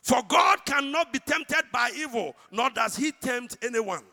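loud, slow preached scripture reading; scripture preaching. Acted. Preacher reading James to congregation, loud and slow. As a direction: proclaim each verse slowly.

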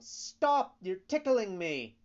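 very high, loud giggling cry; laughing tiny voice. A narrator's reading. Tickled log squeals at the carpenter, high and loud. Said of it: "which said, laughing".

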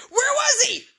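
mock-gasp it in disbelief; astonished squeal. Viewer at the credits, shrieking in astonishment. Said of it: "you're going".

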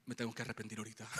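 low, soft and fast frightened thought; panicked inner thought. Retold or imitated. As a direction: mutter it quickly like a last prayer. (pensamiento asustado, bajo y rápido)

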